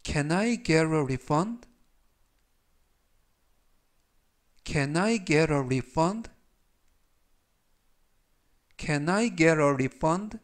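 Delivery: very slow, clear sentence for repeating; 따라 하도록 아주 천천히 읽기; slow reading. Shown as a demonstration. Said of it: "제가 역시 천천히 세 번씩 읽어드리고요". (학생들이 따라 하게 아주 천천히 또박또박)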